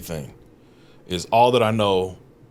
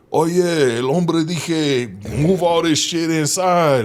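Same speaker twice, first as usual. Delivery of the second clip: higher, louder, very slow fake Spanish; faking Spanish; 8 semitones higher, 5 dB louder, much slower than usual. Bluffing his translation while the foreman watches, slow mock Spanish. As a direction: stretch out made-up Spanish to bluff. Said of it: "you know, I'm just like".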